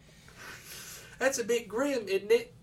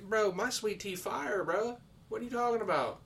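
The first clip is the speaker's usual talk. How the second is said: mock indignant Southerner; 9 semitones higher than usual. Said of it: "I'm sitting here just like".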